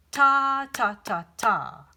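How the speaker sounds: "ta, ta, ta, ta" chanted, the first "ta" punched loud; rhythm syllables. Shown as a demonstration. Teacher demonstrating the second rhythm, with a loud first "ta". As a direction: chant the beat on "ta". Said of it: "the other one is".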